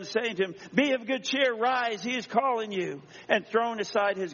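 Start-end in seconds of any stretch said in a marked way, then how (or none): 0.7-3.0 high, lifted call; rousing summons, slowing at the end; calling out encouragement. Acted. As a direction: call the man up, pitch raised. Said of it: "they called the blind man".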